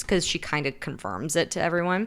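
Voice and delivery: ordinary delivery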